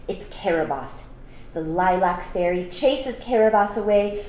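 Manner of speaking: ordinary delivery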